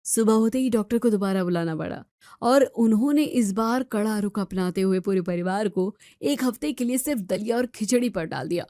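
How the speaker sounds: धीमी, गहरी कथावाचक आवाज़; दृश्य बताते हुए शांत नैरेशन; कहानी सुनाना. A narrator's reading. Story narrator between scenes. (कथावाचक की शांत आवाज़)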